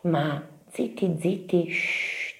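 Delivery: soft, slow, secretive hush. A narrator's reading. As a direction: quieten the listeners, ending in "shh". Narrator hushes listeners to hear noise. (voce bassa che zittisce)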